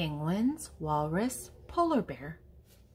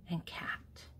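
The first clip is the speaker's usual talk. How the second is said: whisper; 10 dB quieter than usual. Acted. Whispered item in animal list.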